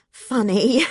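plain reading voice

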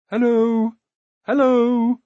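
high, ringing call of hello. A narrator's reading. Prince answering from the darkness, calling out. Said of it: "called another voice".

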